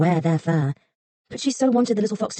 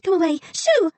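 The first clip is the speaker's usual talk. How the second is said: put-on shooing girl; 12 semitones higher than usual. A narrator's reading. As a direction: shoo the fox off. Girl shoos fox.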